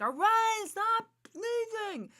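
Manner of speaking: put-on high, loud childish whine, stretched slowly; kids' exasperated plea. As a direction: whine like fed-up kids begging mom to stop. Kids scolding her for sneezing.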